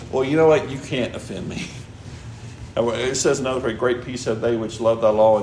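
ordinary delivery

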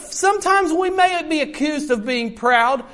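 ordinary delivery

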